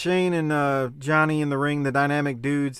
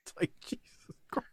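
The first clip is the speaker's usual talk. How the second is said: whispered aside; 15 dB quieter, faster than usual. Real, not acted. Muttered aside.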